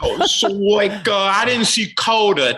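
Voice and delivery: high, loud put-on fan voice; clueless fan voice.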